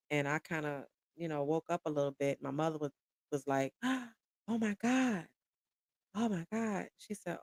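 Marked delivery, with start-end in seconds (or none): 3.8-6.9 slow, high, hushed gasp of shock; breath caught, drawn-out high disbelief; retold shock. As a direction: imitate a mother stunned by terrible news. Mother reacts to bad news, gasping.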